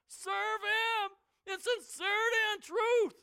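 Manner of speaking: high, loud, slow and quivering; an old man's voice cracking as he pleads. Retold or imitated. Aged Joshua pleads with Israel, voice quivering. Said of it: "Perhaps his voice is quivering".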